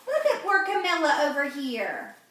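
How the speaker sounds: ordinary delivery, nothing marked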